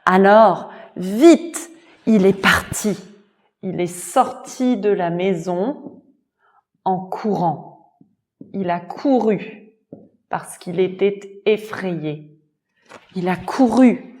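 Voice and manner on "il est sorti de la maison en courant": ordinary delivery, nothing marked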